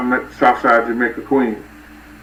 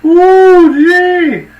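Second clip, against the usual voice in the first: much higher, louder squeal of delight; excited older lady; 7 dB louder than usual. Retold or imitated. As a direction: squeal it like his friend's thrilled mom. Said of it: "She was like".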